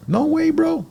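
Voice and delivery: high, cocky no way; cocky scoff; excited scoff. Real, not acted. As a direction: brush the idea off with a laugh. Boasting about kids.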